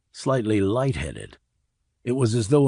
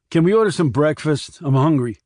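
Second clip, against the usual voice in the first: louder, higher grumpy demand; irritable demand; 5 semitones higher, 6 dB louder than usual. A narrator's reading. Randy demands breakfast at the table, louder and higher.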